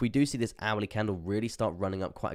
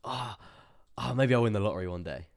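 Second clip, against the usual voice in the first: imitating hopeful people; 3 semitones higher, 5 dB louder than usual. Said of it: "A lot of people are like".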